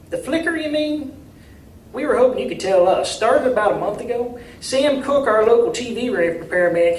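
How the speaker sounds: a down-home mayor, friendly and a bit anxious; small-town mayor. A narrator's reading. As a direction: play the hopeful country mayor asking for help. Mayor voiced in story.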